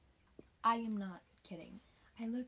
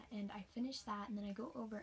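ordinary delivery

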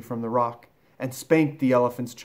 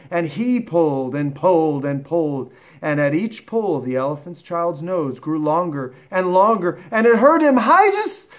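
higher, louder, building to a peak; excited narration; 6 semitones higher, 8 dB louder than usual. A narrator's reading. Narrator telling of the nose stretching, urgent and rising. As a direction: strain along with the pulling, ending high and loud.

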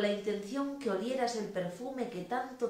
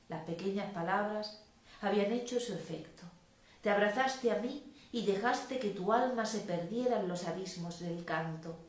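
plain reading voice

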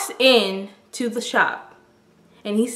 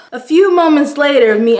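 ordinary talk

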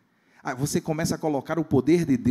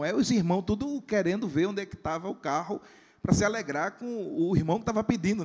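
usual speaking voice

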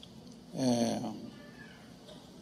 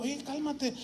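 soft calming; 9 semitones higher, 8 dB quieter, slower than usual. Retold or imitated. Pastor calming the attacker.

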